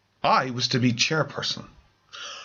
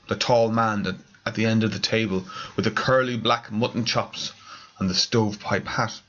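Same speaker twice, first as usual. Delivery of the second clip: plain reading voice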